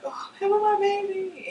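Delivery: high, slow desperate scream; piercing mock scream of pain; retold screaming. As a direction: cry out for help in labour. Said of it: "I'm screaming".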